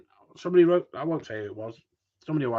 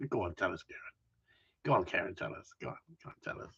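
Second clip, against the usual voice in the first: softer whispered coaxing; teasing whisper; 10 semitones lower, 14 dB quieter, much faster than usual. Real, not acted. Teasing Kieran in a soft conspiratorial whisper. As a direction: egg him on in a hushed whisper.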